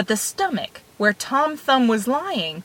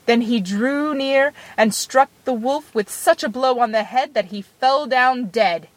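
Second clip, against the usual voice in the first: ordinary delivery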